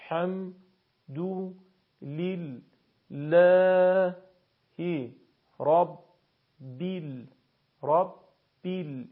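slow syllables with long gaps; syllable-by-syllable recitation. Shown as a demonstration. Teacher repeats verses for learners to practise, slow syllabic recitation. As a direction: hold the long vowel evenly, without melody. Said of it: "براتون بخش خوانی کردم".

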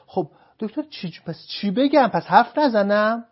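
high, loud, baffled objection; offended spouse, loud and high; indignant protest. Retold or imitated. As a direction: throw up your hands: so what should I say?.

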